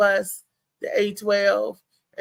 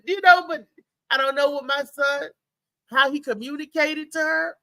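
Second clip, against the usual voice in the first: usual speaking voice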